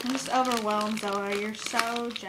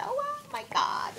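high excited squeal; 12 semitones higher than usual. Real, not acted. Overwhelmed by gifts.

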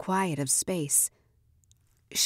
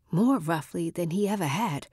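usual speaking voice

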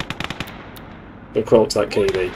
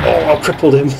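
high, delighted cackle; triumphant little laugh; gleeful laugh; 7 semitones higher, 4 dB louder than usual. Real, not acted.

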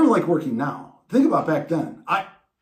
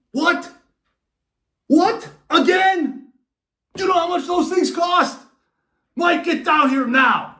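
high, loud furious dad shout; angry dad; 13 semitones higher, 5 dB louder than usual. Retold or imitated. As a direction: yell like a father who can't believe it happened again.

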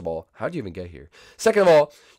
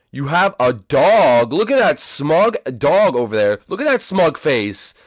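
ordinary talk

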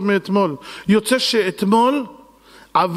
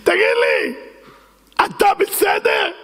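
mock shout; 11 semitones higher, slower than usual. Host shouts at fainting man.

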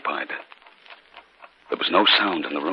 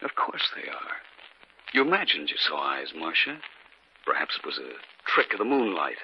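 soft, hushed, soothing voice; husband quietly reassuring his wife; calm reassurance; 3 semitones lower, 7 dB quieter than usual. Acted. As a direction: gently talk her down from fear. Husband calms frightened wife.

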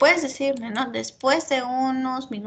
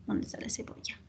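whispered search, talking to herself under her breath; teacher whispering while hunting through a student's recipe; 14 dB quieter, faster than usual. Real, not acted. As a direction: whisper it as you scan the page.

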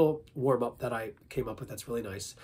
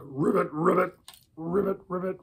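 higher-pitched frog croak; frog ribbit; 4 semitones higher than usual. Shown as a demonstration. Animal warm-up for the voice, frog ribbits. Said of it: "Let's try a frog".

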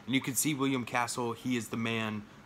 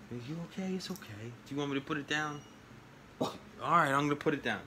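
high, gentle voice for the dog; pet talk; 4 semitones higher, faster than usual. Real, not acted. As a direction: coo at the dog to settle her. Soothing the anxious dog.

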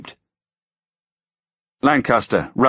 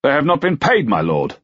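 unembarrassed; 8 semitones higher than usual. A narrator's reading. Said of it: "Felton said without embarrassment".

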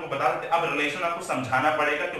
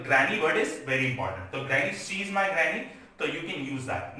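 slow, careful teacher example; slow model phrases; much slower than usual. Shown as a demonstration. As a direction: lay out each word slowly. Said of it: "slow teaching example". (धीरे-धीरे उदाहरण बोलना)

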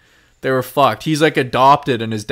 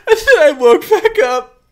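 laughing; 12 semitones higher, 6 dB louder than usual. Real, not acted. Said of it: "laughing through the ending".

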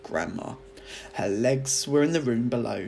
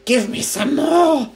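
higher, louder, slow gloating crone; screechy old grandma; 12 dB louder, slower than usual. A narrator's reading. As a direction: drag out the boast like a cackling witch. Grandma demanding more.

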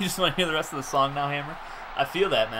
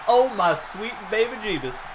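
drawn-out amazement; 5 semitones higher than usual. Real, not acted. Reacting to huge play.

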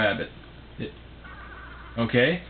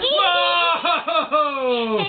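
high laughing whoa; excited cheer. Real, not acted. Cheering toddler's answer.

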